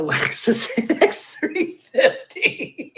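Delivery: laughing nervously mid-sentence. Real, not acted. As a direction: giggle awkwardly through the ask. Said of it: "That nervous laugh".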